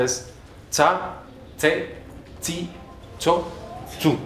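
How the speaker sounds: "tsa, tse, tsi, tso, tsu" with a crisp ts, high; a crisp ts on "tsa, tse, tsi, tso, tsu", one by one; Esperanto C syllables. Shown as a demonstration. Teacher drilling the Esperanto C syllables. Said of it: "es sin excepción".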